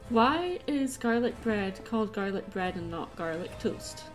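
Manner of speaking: ordinary talk, nothing marked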